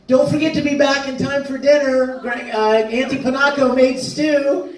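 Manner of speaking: high sing-out from a porch; Winry calling out. Acted. Acting Winry calling Ed and Al home for dinner, high calling-out voice. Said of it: "Winry's on the porch going".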